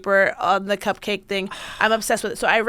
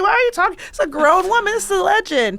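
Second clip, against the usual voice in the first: high-pitched, amused outrage; mock indignation; 8 semitones higher, 4 dB louder, faster than usual. Defending a grown woman who is a legend, mock indignant. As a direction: protest hotly on a legend's behalf, half joking.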